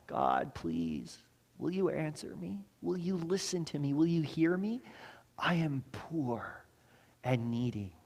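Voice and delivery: soft, hushed plea to God; a soft, slow desperate prayer; pleading prayer. Pretend. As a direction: beg quietly, as if crying out from need. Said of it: "crying out to God".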